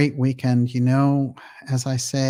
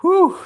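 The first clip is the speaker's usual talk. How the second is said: a sharp, much higher and louder whew; high whistle-like whew; 9 dB louder than usual. Real, not acted. Host reacts to a viewer's message with a high, loud whew. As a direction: let out a high impressed whew.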